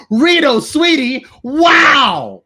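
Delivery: high, loud, slow showtune line; hammed-up school actor. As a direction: belt it like an eager schoolboy actor. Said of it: "there's me going".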